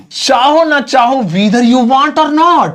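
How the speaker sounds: "चाहो ना चाहो whether you want or not" pitched high and drawn out; model pronunciation. Shown as a demonstration. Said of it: "इसे सुनिए कैसे बोलेंगे". ("whether you want or not" ऊँचे सुर में, खींचकर सिखाते हुए)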